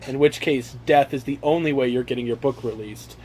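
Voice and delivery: normal talking voice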